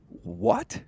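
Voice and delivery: one word shot up very high; blindsided, caught off guard; shocked what. Retold or imitated. Host playing a customer stunned by the upsell, high disbelief.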